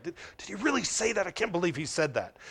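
quick, hushed, disbelieving mutter; irate muttering to oneself. Acted. Preacher replaying David's fuming on the road, hushed irate muttering. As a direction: stew under your breath, fast and soft. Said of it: "getting more irate every time he passes through that".

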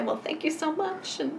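high, sing-song fake politeness, breaking into a laugh; mock polite brush-off. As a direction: sweetly show someone the door, half laughing. Imagined listener politely dismissing her.

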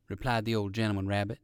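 ordinary delivery, nothing marked

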